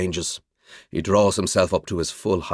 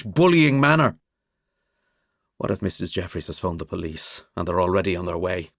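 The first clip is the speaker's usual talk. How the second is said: plain reading voice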